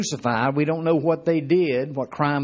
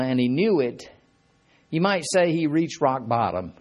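normal talking voice

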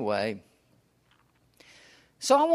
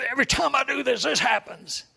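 higher, mock-whiny complaint; frustrated friend; 9 semitones higher than usual. Quoting the friend to him, mock-whiny and higher. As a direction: imitate the friend's whine for a moment. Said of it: "because you get frustrated".

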